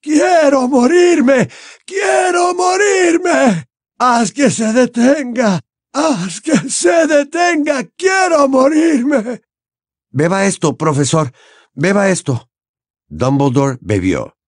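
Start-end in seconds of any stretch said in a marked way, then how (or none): 0.0-9.4 much higher, slow, loud wailing; heart-rending screams. Acted. Dumbledore screaming in torment, wailing. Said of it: "lanzaba gritos desgarradores". 10.1-12.5 put-on higher, pressing young voice; urging Harry. Harry pushing Dumbledore to drink, urgent.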